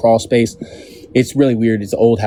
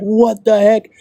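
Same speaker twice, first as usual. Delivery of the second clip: surprised; 9 semitones higher, slower than usual. Retold or imitated. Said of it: "I'm like".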